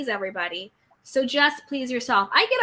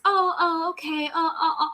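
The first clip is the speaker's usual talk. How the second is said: higher-pitched, indulgent little ohs; mock coddling; 5 semitones higher than usual. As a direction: coo as if excusing a naughty favourite. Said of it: "and it's all coddled".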